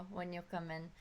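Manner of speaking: ordinary delivery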